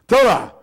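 Thura said as THOO-rah, stress on the first syllable; Greek pronunciation. Shown as a demonstration. Preacher teaching the congregation the Greek word Thura, careful. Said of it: "it's pronounced as thura".

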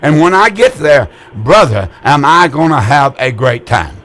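ordinary delivery

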